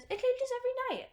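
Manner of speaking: very high, loud imitation of a smug know-it-all; mock smug. Newt tells the newcomer about the maze, mock smug. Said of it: "in a very smug attitude".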